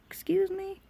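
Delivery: put-on a child's offended look put into words; sassy excuse me. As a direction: give it full little-girl attitude. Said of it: "Chloe looked at her like".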